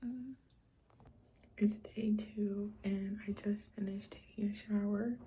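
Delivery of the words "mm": ordinary talk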